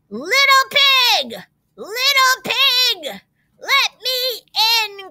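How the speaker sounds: high, slow, drawn-out wolf call; growling wolf. A narrator's reading. Wolf at the pig's door, high menacing call. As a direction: stretch out each call like the big bad wolf. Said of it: "growled the wolf".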